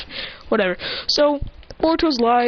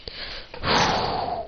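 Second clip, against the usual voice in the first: soft, long breath out; long exhale; 7 dB quieter than usual. Retold or imitated. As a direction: blow out a quiet, steady breath. Imitating Boruto's breath before attack.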